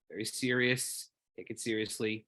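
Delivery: hushed, measured stoic self-talk; stoic, serious. Retold or imitated. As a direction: quietly tell yourself to stay serious, lightly stoic. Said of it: "you know, Spock kind of thing".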